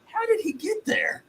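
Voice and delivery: slow, high baffled squeak. Retold or imitated. Player wondering how the worker got there, slow high baffled. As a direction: stretch it out high in disbelief. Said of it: "I'm like".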